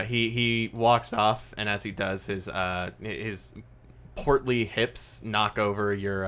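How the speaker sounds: usual speaking voice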